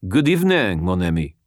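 put-on smooth French-accented greeting. A narrator's reading. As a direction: purr it like a Parisian. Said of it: "in the hideously accurate French accent".

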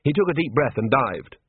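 ordinary delivery

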